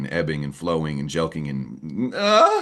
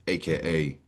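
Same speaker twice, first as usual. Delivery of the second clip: usual speaking voice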